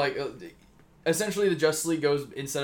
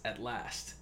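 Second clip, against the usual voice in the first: quiet flat robot voice; a hint of a machine speaking softly; 4 semitones lower, 12 dB quieter than usual. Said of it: "A robotic voice says".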